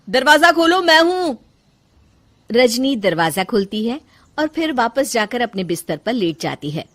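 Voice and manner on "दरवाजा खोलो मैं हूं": high, loud call through a door; raised voice, carrying to another room. Acted. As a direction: call to be let in. Husband calls through the door.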